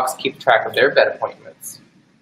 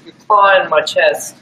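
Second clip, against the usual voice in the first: usual speaking voice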